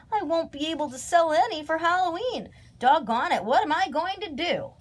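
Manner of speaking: high, swooping complaint; wailing. A narrator's reading. Young scarecrow losing his Halloween sales, high wail. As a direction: wail it in storybook dismay. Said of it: "wailed Jeb".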